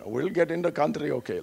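put-on light Pakistani accent, friendly. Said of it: "like, in the Pakistani accent".

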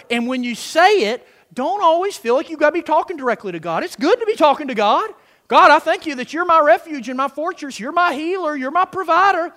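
loud, high, insistent sermon delivery; fired-up preaching. Real, not acted. Preacher telling congregation to say it of Him, fired-up preaching. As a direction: keep it lifted and forceful, declaring it.

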